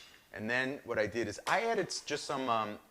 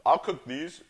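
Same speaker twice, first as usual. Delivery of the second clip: normal talking voice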